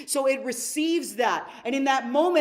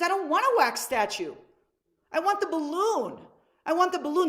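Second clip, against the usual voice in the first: higher, pleading child voice, a light kid impression; a boy demanding the balloon, pitched up; whiny little boy; 6 semitones higher than usual. Little boy pleading with his dad at the zoo, higher whiny child voice.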